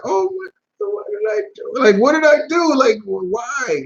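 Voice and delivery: put-on high, pleading voice of a hurt fourteen-year-old; whiny bewildered kid. Retelling himself kicked as a boy, high bewildered whine. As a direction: squeak it out, baffled and wounded. Himself after nut shot.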